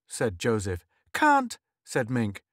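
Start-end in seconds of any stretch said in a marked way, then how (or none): 1.1-1.6 flat refusal. Acted. Girl refusing boy.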